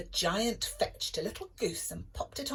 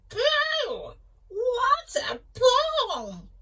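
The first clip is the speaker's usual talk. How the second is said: much higher, loud slow shout; harp shouting; 9 dB louder, much slower than usual. A narrator's reading. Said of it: "the harp shouted".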